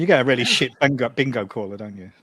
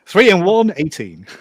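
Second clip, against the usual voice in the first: bingo caller; 5 semitones higher, 6 dB louder, slower than usual. Retold or imitated. Bad bingo caller parody.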